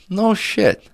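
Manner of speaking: high-pitched mock disbelief; amazed reaction. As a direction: act impressed, pitch leaping up. Joke customer hears of magic apple.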